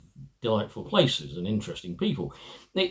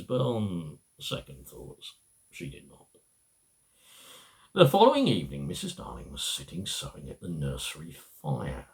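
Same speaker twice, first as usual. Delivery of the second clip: ordinary delivery, nothing marked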